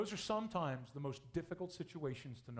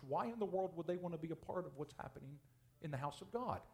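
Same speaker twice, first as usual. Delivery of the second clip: ordinary delivery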